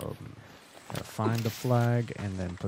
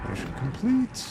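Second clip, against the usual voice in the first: high, louder triumphant call; excited; 11 semitones higher, 4 dB louder than usual. Real, not acted. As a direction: announce the win with a lift. Second mission done.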